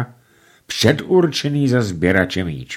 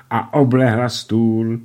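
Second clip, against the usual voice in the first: ordinary delivery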